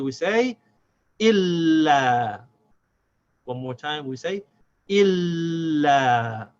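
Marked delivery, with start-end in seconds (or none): 1.2-2.4 ILLA with a long held l running into la; joined, unbroken sound. Shown as a demonstration. Teacher joining il and la together, without a stop. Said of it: "without stopping the sound of the lam". 4.9-6.5 ILLA in one breath with a long l; joined, unbroken sound. Shown as a demonstration. Teacher saying it one more time, without a stop. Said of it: "without stopping the sound of the lam".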